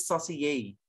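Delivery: "Saucier" as "so-si-YAY"; pronunciation demo. Shown as a demonstration. Teacher shows the class the joined word, "Saucier" as "so-si-yay". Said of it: "that's you pronounce it".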